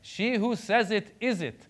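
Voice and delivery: put-on high, slow Iranian-accented retort; singsong mother with an Iranian accent; mom's Iranian accent. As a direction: deliver mom's proud comeback in an Iranian accent. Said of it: "has the accent, and she talks like this".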